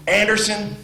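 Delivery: high call of the name; coach calling. Retold or imitated. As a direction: call the freshman over. Coach calls the player.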